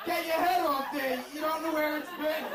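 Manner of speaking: high-pitched panicky warning; mock alarm. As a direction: play up the embarrassed urgency. Urgent warning to father-in-law.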